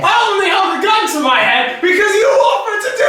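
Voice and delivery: laughing, high and loud; barely holding in laughter; trying not to laugh. Real, not acted. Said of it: "I couldn't keep a straight face".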